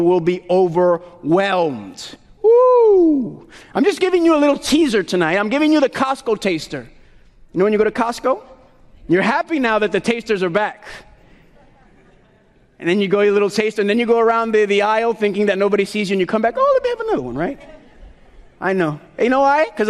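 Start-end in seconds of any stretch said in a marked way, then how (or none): 2.4-3.5 a high, loud whoop of excitement; excited whoop. Real, not acted. Preacher whoops high and loud at the congregation. 16.6-17.3 high, sheepish mock voice; sneaky sample-taker. Preacher imitates a shopper sneaking another Costco sample in a high voice.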